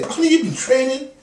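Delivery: high, loud mock-wife squeal of a question; wife's voice. Acting wife's surprised question to husband.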